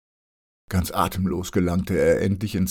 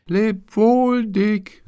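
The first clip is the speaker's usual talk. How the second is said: much higher, louder call; calling as loud as he can; 6 dB louder, much slower than usual. A narrator's reading. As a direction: call out over the harbour noise. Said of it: "rief er, so laut er konnte". (so laut er kann rufend)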